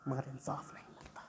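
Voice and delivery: hushed, voiceless film-dialogue payoff; Sunny Deol, hushed. Acted. Quoting a courtroom film line about justice denied, whispered. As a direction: drop to a near whisper. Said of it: "Sunny Deol बोलेगा".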